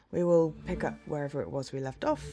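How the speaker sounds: normal talking voice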